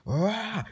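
high, sudden play yelp; pained yell. Pretend. Person bumped in the line, yelping. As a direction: cry out as if hurt. Yells on being touched.

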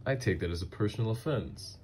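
mock offended. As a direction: defend it as if personally insulted. Mock offence over tuxedo insult.

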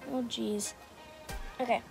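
ordinary talk, nothing marked

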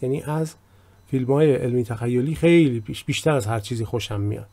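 usual speaking voice

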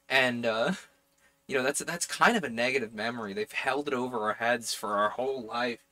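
ordinary delivery

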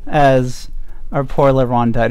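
ordinary talk, nothing marked

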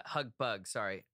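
ordinary talk, nothing marked

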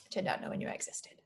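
very soft, nearly whispered; hurt confession. Acted. Daughter admits her father kept her secret, hushed and hurt. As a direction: let it drop to a hushed admission.

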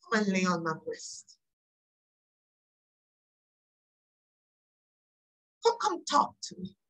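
soft, hushed voice of God inviting; tender invitation. Acted. God calling the hurting to come and rest, tender. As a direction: draw them in gently, with long pauses.

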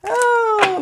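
squealed high oh; high surprised oh. Real, not acted. Reader startled by the message, high surprised oh. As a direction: let out a shrill oh. Shocked exclamation.

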